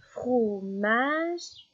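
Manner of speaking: "Fromage" stretched as fro... mage; split into syllables. Shown as a demonstration. Teacher helping a child spot the last syllable, "Fromage" split and stretched. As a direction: hold each half of "Fromage". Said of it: "repérer ces dernières syllabes".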